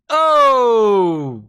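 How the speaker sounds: loud, startled Oh; loud exclamation. Real, not acted. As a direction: burst out at the play. Reacting to the play.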